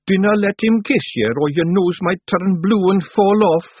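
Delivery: a tiny Scots rascal joking merrily; cheerful Scottish Feegle. Acted. Feegle Woolly jokes cheerfully.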